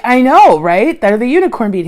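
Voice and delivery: excited. Retold or imitated. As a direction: replay the thrill of finding the bead. Retelling her excited reply to vendor.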